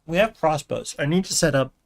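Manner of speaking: talking with a mouthful; words round a bite of food; chewing while talking. Real, not acted. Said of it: "I'm chewing again".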